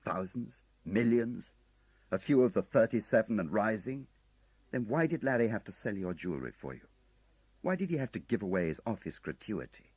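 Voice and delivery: angry outburst at a wife who hid money. A narrator's reading. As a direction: fire the questions at her one after another. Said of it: "angry with her at long last".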